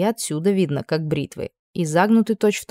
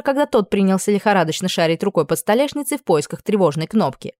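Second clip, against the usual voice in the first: usual speaking voice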